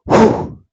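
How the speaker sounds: sharp loud breathy blow; blowing sound. Acted. Barkeep blows dust off the bottle, a loud blow.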